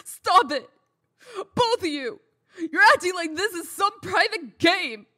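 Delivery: sharp, loud high shout to break up the fight; furious and fed up, voice pitched high; angry outburst. Acted. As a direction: yell at them both to stop. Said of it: "pitching a fit".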